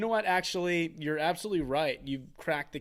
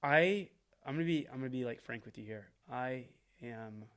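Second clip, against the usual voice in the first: mock earnest confession; 4 semitones lower, 8 dB quieter than usual. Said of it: "literally just being like".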